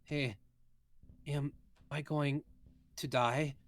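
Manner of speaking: put-on slow, frail worried girl; weak Eris. Seasick Eris voiced.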